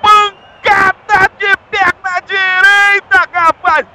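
high, shouted goal call; explosive goal commentary. Real, not acted. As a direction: belt out the goal replay. Goal replay narration.

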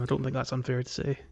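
normal talking voice

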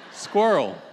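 loud "Squirrel" as one-syllable "skwurl", Southern style; Alabama accent. Shown as a demonstration. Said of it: "S K E R W L".

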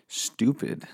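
normal talking voice

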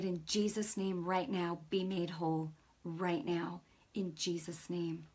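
low, softly spoken healing prayer; retold quiet prayer. Retells praying over a woman.

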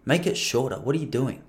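quiet, confidential gangster impression. Comedian pulls a mate aside to advise him, hushed gangster voice. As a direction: imitate a gangster giving advice under his breath. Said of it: "I made him sound probably a little more gangster".

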